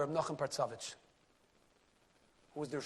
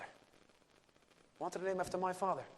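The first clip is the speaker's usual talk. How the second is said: plain; 7 dB quieter, slower than usual. Retold or imitated. Repeated slower, softer.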